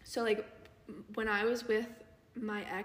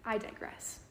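whispered aside; 5 dB quieter than usual. Real, not acted. Aside after a tangent.

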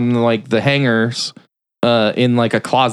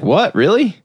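higher, mock-surprised questions; retold disbelief, rising at the end; 9 semitones higher than usual. He reacts to news of the move, mock surprised.

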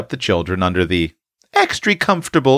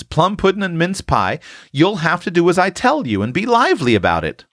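put-on high, insistent girl overruling an objection; spirited; 10 semitones higher than usual. A narrator's reading. Tilly pushing Eph to obey her orders, spirited. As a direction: argue it with spirit, pitched well up throughout. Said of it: "Tilly spoke with such spirit".

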